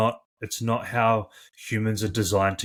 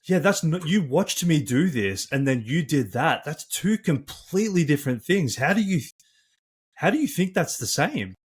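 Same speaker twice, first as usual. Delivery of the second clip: high, incredulous complaint; exasperated; 6 semitones higher than usual. Retold or imitated. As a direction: pitch up in disbelief. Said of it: "and you're like".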